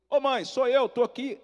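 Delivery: high, loud phone greeting; eager calling out. Retold or imitated. Son phoning mother after years away, eagerly calling out. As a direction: call out to be recognised.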